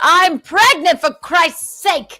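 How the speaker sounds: outraged, drawing out for Christ's sake; indignant outburst. Acted. Friend telling off the harasser on her behalf, indignant.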